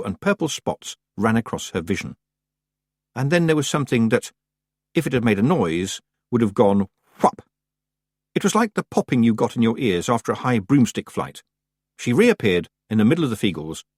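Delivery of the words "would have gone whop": plain reading voice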